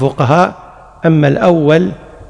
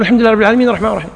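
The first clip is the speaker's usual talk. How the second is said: very fast repeat of "الحمد لله رب العالمين الرحمن الرحيم"; follower hurrying; 3 semitones higher, much faster than usual. Shown as a demonstration. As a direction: rush to keep up with the imam. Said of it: "تقول". (ترديد سريع جدا للحاق بالإمام)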